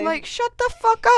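high, loud shut the fuck up; fed-up high yell at the sister; exasperated outburst. Retold or imitated. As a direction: blurt it out fed up.

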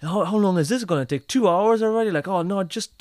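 impatient tourist rising into a tantrum; mock irate traveller at customs; angry tourist. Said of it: "an angry tourist who's like".

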